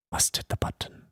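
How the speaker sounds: hushed whisper to himself. Real, not acted. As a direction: whisper it like a secret urge.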